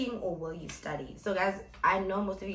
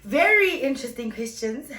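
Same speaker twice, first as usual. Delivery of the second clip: loud teasing hype; 7 semitones higher, 13 dB louder than usual. Real, not acted. Teasing the questions ahead.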